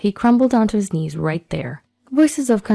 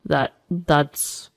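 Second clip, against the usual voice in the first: low, faltering false start; flustered stammer; 3 semitones lower than usual. Acted. Ex-husband questioned by his girlfriend, flustered. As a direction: repeat the word, lost for excuses.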